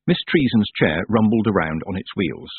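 usual speaking voice

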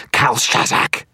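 high, loud strained screech. A narrator's reading. Sorcerer calling the demon last time, screeching. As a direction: screech the name as a final summons. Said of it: "his voice rising to a screech".

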